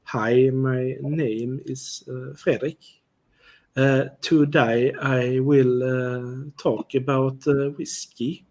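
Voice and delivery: slow, halting Swedish English accent. Shown as a demonstration. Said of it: "if I would speak Swedish English here".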